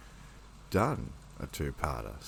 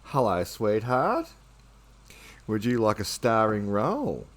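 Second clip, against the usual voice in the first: cooing greeting; 4 semitones higher, 6 dB louder than usual. Real, not acted. Greets cat on camera.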